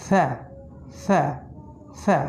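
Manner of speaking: সা said lightly with the tongue at the teeth; Arabic letter sound. Shown as a demonstration. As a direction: repeat সা at the teeth. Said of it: "আলিফ বা তা সা এমন নয়".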